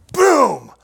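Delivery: boom. Retold or imitated. Headbutting himself, story climax.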